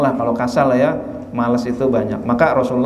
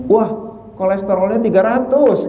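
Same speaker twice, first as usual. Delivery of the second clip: 1 surprised doctor; 7 semitones higher than usual. Retold or imitated. Doctor reads cholesterol.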